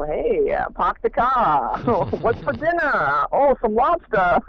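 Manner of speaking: broad New England accent, playfully exaggerated; Maine accent. Shown as a demonstration. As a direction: lay on a thick Maine accent, laughing between phrases. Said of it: "authentic Maine accent".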